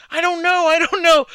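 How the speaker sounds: very high, loud panicked imitation; mock panic. Cornered worker answers his yelling boss, high mock panic. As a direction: squeal it helplessly, hammed-up.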